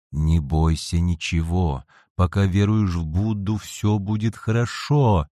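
ordinary delivery

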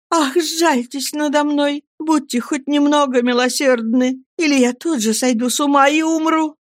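a touch of hysterical pleading; desperate plea. A narrator's reading. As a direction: implore pity on the edge of breaking. Emily begging.

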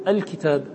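"الكتاب" opened on a clear glottal "al-"; initial hamza sounded. Shown as a demonstration. Teacher showing students the article, "الكتاب" with initial hamza. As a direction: start "الكتاب" with an audible hamza. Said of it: "نقول هذا بالهمزة".

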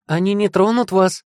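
high, urgent shout; calling out. A narrator's reading. Daughter calling to approaching father, urgent cry. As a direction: cry out high to reassure them. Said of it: "крикнула".

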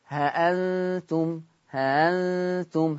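ها أنتم slow, the hamza eased between hamza and alef; eased hamza demo. Shown as a demonstration. Teacher shows tashil on ها أنتم. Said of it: "اوزنوها على كلمة أعجمي".

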